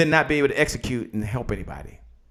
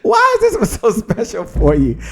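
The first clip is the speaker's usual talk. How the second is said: laughing question; 5 semitones higher, 4 dB louder than usual. Real, not acted. Repeats question after cracking up.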